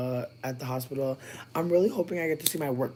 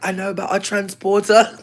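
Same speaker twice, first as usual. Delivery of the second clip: mock British; 7 semitones higher, 11 dB louder than usual. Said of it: "Dane's dumbass is like".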